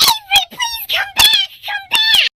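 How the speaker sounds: high-pitched pleading call; retold yell. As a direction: re-enact yelling for a child to return. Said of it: "I stand there and yell".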